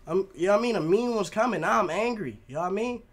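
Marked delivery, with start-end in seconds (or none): none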